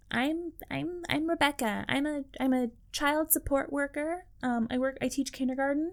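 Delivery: high, very soft, hesitant introduction; imitated dating-show contestant. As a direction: play a meek kindergarten teacher introducing herself. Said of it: "all the Bachelor and Bachelorette contestants look the same".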